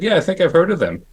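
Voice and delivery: lightly lisped, lifted voice; Dick Smith lisp. Retold or imitated. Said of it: "He had a little bit of a lisp".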